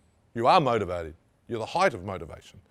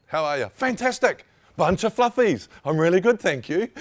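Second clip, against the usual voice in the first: mock overbright chirpy greeting, much higher and louder; hammed-up fake enthusiasm; fake cheerful; 8 semitones higher, 8 dB louder than usual. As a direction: gush it like a falsely cheerful office worker. Mock chirpy Kiwi office greeting.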